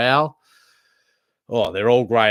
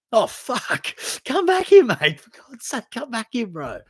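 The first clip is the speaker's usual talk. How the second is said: high, loud mock yell after someone running off; retold call after him; 12 semitones higher, 4 dB louder than usual. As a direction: shout after the kid in the dark, half laughing. Calling after student with torch.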